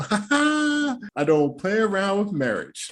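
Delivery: high, slow, prissy mocking take; hammed-up high slow imitation of Jasmina; mock prim quote. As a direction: repeat her line slowly in a high mock voice. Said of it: "Came out the lips of Jasmina".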